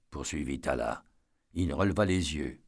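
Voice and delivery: usual speaking voice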